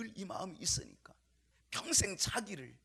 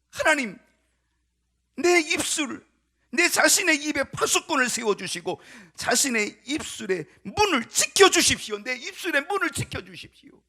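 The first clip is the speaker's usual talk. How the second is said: loud, high-pitched pleading prayer; fervent prayer; 9 semitones higher, 15 dB louder than usual. Acted. David praying to God to guard his lips, fervent. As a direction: cry out urgently to God. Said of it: "하나님께 기도하는 거예요".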